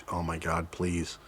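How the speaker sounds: quiet desperate plea. Retold or imitated. Panicking, unable to pee.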